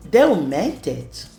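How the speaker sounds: usual speaking voice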